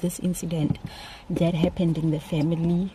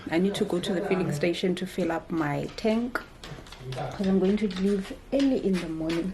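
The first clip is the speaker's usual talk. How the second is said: ordinary talk, nothing marked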